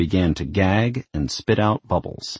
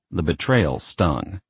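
ordinary delivery, nothing marked

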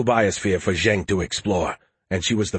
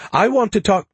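high, loud whiny plea; loud protest; 11 semitones higher, 5 dB louder than usual. A narrator's reading. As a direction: insist loudly. Skippy protests loudly.

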